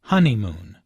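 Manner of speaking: "honeymoon" with the weight on HON; HON-ey-moon, falling tune; stress on first syllable. Shown as a demonstration. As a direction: hit the first syllable of "honeymoon", let the rest fall away. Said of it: "with the stress on the first syllable".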